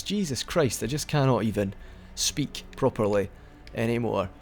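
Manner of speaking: ordinary delivery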